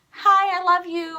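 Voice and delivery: high, loud, drawn-out fan greeting; gushing greeting. Acted. Fan greeting her idols as she wished she had, gushing. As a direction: gush it slowly and high.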